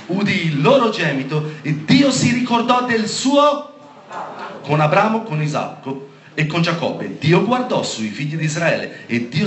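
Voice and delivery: high, loud preacher reading the verse; emphatic scripture proclamation. Real, not acted. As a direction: proclaim it to the whole congregation. (proclamazione biblica a gran voce)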